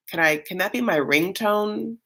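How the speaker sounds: usual speaking voice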